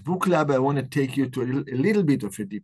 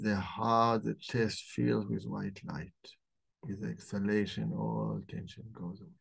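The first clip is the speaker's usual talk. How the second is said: calm guided breathing meditation; 4 dB quieter than usual. Acted. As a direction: ease them into the breath, gently.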